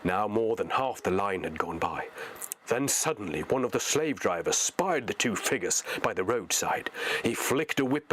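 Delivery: plain reading voice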